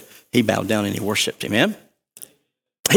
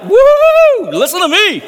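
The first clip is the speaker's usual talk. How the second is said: very high, loud whoop; a whoop through a megaphone, high and loud; 8 dB louder, slower than usual. Shown as a demonstration. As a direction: blast it like a megaphone. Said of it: "It's like".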